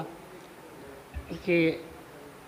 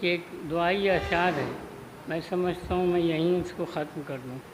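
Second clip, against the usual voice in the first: ordinary delivery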